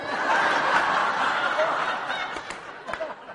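high unvoiced burst of laughter; big laugh. Real, not acted. Pastor laughs at being mistaken for a famous preacher, high bursts. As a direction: laugh hard at the mix-up.

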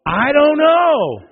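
put-on high, slow whiny kid voice; sulky kid. Child answering the parent, whiny and slow. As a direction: drag it out like a shrugging child. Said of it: "And they go".

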